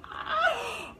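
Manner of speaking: high-pitched cry of excitement; sudden high squeal on opening a book; thrilled "ah" leaping up high; excited gasp. Real, not acted. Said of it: "I'm so excited".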